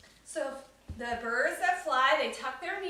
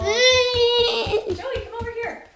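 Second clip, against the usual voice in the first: loud high call across the room; 10 semitones higher, 13 dB louder, much slower than usual. Real, not acted. Mother calling her son Joey over during class, loud sing-song call.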